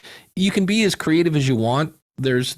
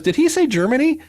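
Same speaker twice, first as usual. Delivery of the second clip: brother's surprise; 14 semitones higher, 4 dB louder than usual. Retold or imitated. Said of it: "my brother goes".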